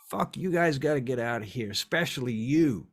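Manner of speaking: loud, exasperated demand; angry eviction. Acted. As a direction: order them out, singling one out. Angry host orders guests out.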